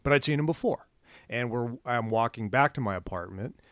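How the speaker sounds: normal talking voice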